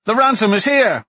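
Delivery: loud alarmed call; shouting. Acted. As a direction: shout the warning across the ship. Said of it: "he shouted".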